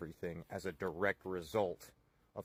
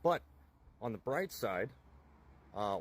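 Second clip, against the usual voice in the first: normal talking voice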